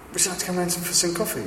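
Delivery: high, soft mock German accent; quick invitation in a put-on German accent; Margot's German accent. German woman inviting neighbour for coffee, imitated German accent. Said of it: "every time I do Margot's accent".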